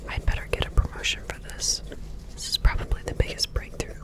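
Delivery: quick, hushed whisper to oneself; low-volume, hurried private note; whispered aside. Acted. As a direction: whisper it privately, under the breath. Whispered aside within the roleplay.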